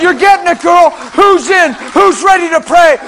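loud, high altar call; rally the crowd to pray; rousing call. Retold or imitated. Calling the porch to prayer.

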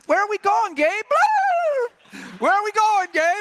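high, put-on silly turkey voice; clueless turkey. Turkey asking angel Gabriel where they're going, high and clueless. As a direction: pitch it way up as a clueless bird. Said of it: "I think Gabriel was just walking him".

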